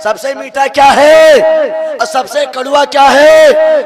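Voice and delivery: slow, loud pulpit shout; shouted preaching. Acted. Preacher to the gathering on the sweetest and bitterest tongue, shouted sermon. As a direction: hammer the lesson out to the congregation.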